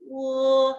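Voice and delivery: 우 held level with a touch of 유 in it; slight 유 glide. Shown as a demonstration. Teacher modelling the vowel, 우 tinged with 유. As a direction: slip a little 유 into a long 우. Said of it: "유 살짝 넣어서 발음해야 돼".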